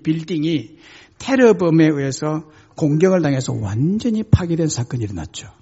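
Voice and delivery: ordinary talk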